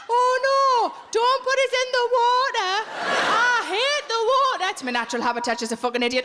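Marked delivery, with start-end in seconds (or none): 0.1-4.7 a higher-pitched, louder mock frog wailing; panicking frog. A frog begging not to be put in the water, squeaky and panicked. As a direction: whine like a frog being drowned. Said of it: "a frog going".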